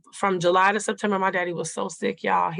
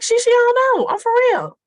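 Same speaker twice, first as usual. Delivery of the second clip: imitating daddy, much higher and louder, stammering; confused father; 9 dB louder than usual. Daughter voicing her confused father insisting, much higher and louder. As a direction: play the bewildered dad protesting he truly forgot.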